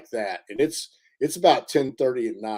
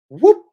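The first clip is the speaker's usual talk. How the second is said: a sudden high, loud whoop, much higher and louder, copying the creature's call; imitated Bigfoot whoop; 14 semitones higher, 12 dB louder than usual. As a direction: throw out a short piercing whoop like the call heard in the woods.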